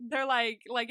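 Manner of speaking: normal talking voice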